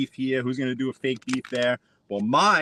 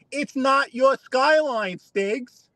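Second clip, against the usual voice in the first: heated rant; 11 semitones higher than usual. Real, not acted. Ranting at rival Stiggs.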